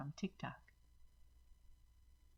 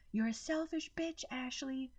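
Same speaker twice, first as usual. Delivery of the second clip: high, far furious yell; 10 semitones higher than usual. A narrator's reading. Rose yelling at her sister on the way out, high. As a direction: spit the insult at her sister.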